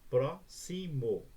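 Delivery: "Próximo" slowly, in three separate beats; syllable by syllable. Shown as a demonstration. Teacher demonstrating a three-syllable word, syllable by syllable. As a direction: break "Próximo" into pró-xi-mo with gaps. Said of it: "três sílabas".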